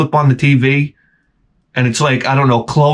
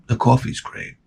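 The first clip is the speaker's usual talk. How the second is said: hushed whisper to yourself; 2 semitones lower, 6 dB quieter than usual. Shown as a demonstration. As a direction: whisper "The coffee's great." with no voice. Same line repeated, breathless.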